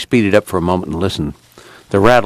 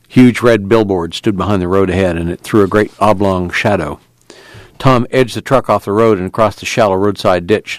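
ordinary delivery

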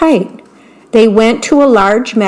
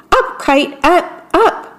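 shout; 6 semitones higher than usual. Acted. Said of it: "and shout".

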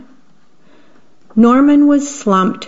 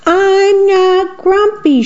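high, slow shout of denial; 10 semitones higher, slower than usual. Acted. Grumpy chimp shouting at the other animals, high and slow. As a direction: yell it out slowly, pitched way up. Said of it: "shouted Jim as he beat his chest".

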